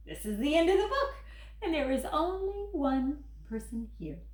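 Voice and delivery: fast, laughing relief. Acted. Grover laughs to the kids at the end of the book. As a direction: laugh through the discovery.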